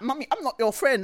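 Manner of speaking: sulky son. Retold or imitated. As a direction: pout it like a small boy sulking. Son telling mum off.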